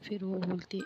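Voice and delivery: plain reading voice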